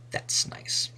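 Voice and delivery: soft unvoiced whisper to herself; whispered aside. Real, not acted. Teacher whispers a wry aside after the troll sentence. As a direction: breathe it out as a private aside.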